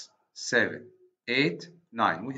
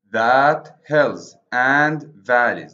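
"That hills and valleys" said slowly and much louder; slow scanning; 9 dB louder, slower than usual. Shown as a demonstration. Teacher reading a line aloud to show its meter, slow and loud. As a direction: scan each beat slowly and firmly. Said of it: "pay attention to the word valleys".

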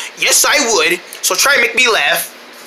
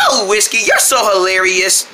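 ordinary talk

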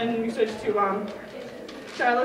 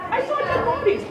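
put-on high, loud girlish alarm; hammed-up frightened report; mock alarm; 12 semitones higher, 6 dB louder than usual. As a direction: blurt it out high like a rattled daughter. Imitates distressed daughter.

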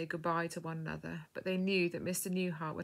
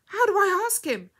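high, loud question; desperate cry; 13 semitones higher, 11 dB louder than usual. A narrator's reading. Dying girl begging the woman for guidance, desperate cry. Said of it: "cried the girl".

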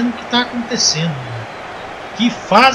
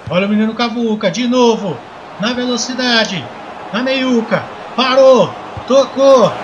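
play-by-play commentary; 3 semitones higher, slower than usual. Acted. Live football play-by-play.

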